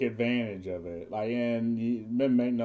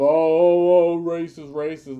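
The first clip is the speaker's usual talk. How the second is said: hammed-up cry, higher and loud at first; mock accusation; 5 semitones higher, 10 dB louder than usual. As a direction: mimic an accuser raising the alarm, pitched up. Mimics accusers crying racist.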